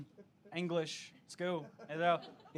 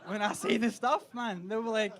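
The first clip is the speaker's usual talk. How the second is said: ordinary talk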